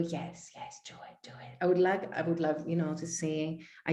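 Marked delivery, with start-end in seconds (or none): 0.0-1.6 soft urging whisper; whispered inner voice. Retold or imitated. The little voice inside urging yes, whispered. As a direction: hush it like a secret voice. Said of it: "this little".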